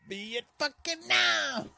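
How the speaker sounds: high, loud, drawn-out cartoon yell; exaggerated cartoon outburst; Elmer Fudd. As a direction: holler it slowly like a worked-up cartoon character. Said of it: "It looked like Elmer Fudd would".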